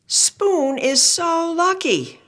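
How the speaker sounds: high, slow envious friend; wistful high voice; friend character voice. Acted. Chopsticks envy Spoon.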